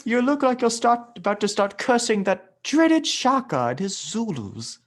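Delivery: high, slow hammed-up colonial British; mock British colonel; mock British accent. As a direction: play an old colonial Brit, hammed up. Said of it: "British accent".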